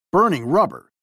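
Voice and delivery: usual speaking voice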